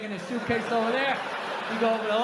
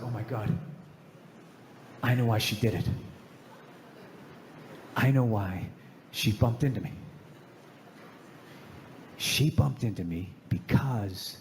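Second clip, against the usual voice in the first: dramatic realization; 11 semitones lower than usual. Retold or imitated. Sudden realization while washing dishes.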